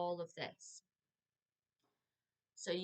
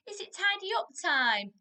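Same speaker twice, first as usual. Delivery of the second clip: put-on high, louder sing-song question to a child; sing-song parent question; 9 semitones higher, 10 dB louder than usual. Shown as a demonstration. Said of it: "mimicking parent asking".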